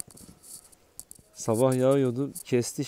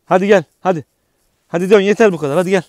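calling out loud; 5 semitones higher, 11 dB louder, faster than usual. Real, not acted. Calling the dog back outside.

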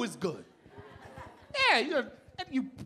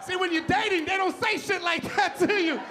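usual speaking voice

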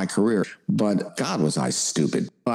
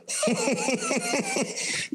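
high laugh; 6 dB quieter than usual. Retold or imitated. Recalling John Candy's laugh.

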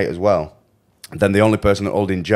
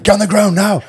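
high, loud barked order; imitated police shout; 12 semitones higher, 5 dB louder than usual. Storyteller voices police arriving round the corner, imitated shouted order. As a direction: shout it like arresting officers.

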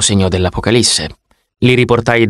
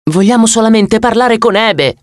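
higher, pressing the point; insist, a bit more urgently; 10 semitones higher than usual. Acted. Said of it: "provai a insistere". (insistente, più acuto)

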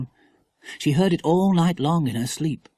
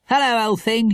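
higher, lightly voiced boy; louder, cheery schoolboy hello; teasing brother; 9 semitones higher, 5 dB louder than usual. A narrator's reading. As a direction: greet a sister jauntily. Boy greets his sister.

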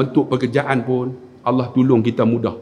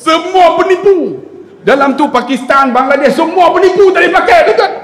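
tinggi dan lantang, berjenaka; jeritan mengecam; 14 semitones higher, 6 dB louder than usual. Retold or imitated. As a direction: laungkan tuduhan secara melodrama. Mengecam penipu secara berjenaka. (jerit mengecam dengan gaya lucu)

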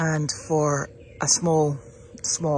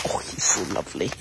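a soft whispered aside; 9 semitones lower, 5 dB quieter than usual. Real, not acted. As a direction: whisper fondly about the puppy. Aside about the puppy.

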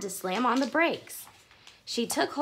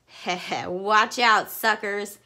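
a louder sneering taunt; bully yelling taunt from his bike; mocking yell; 5 dB louder, slower than usual. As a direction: jeer at them as you ride past. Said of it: "yelled Max".

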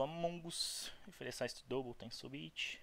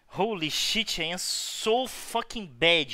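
self-disgusted outburst; 6 semitones higher, 10 dB louder, slower than usual. Real, not acted. Berating own play.

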